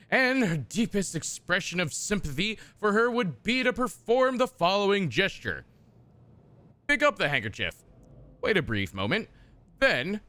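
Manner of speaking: a hint of a stately narrator; mock courtly reading. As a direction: read it like a dusty noble manual. Reads game codex text aloud.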